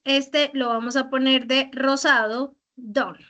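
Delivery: normal talking voice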